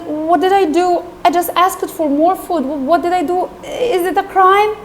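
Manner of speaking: hurried, puzzled self-defence, acted; bewildered Oliver. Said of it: "Oliver was just looking like this".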